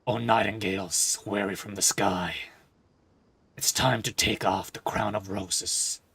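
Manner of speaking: slow, low recited verse; poem recitation. Acted. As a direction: read the song as a poem. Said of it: "it's just gonna be a poem".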